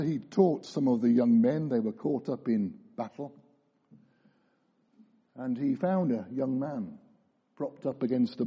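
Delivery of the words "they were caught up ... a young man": usual speaking voice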